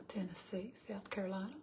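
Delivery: trailing into a whisper; whispered list. Real, not acted. Listing states she has visited, whispered to herself. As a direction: whisper the names, half to yourself.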